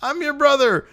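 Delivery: high mock voice of the brother confused at being yelled at; whiny brother. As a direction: squeak out a hurt protest. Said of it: "And his brother's like".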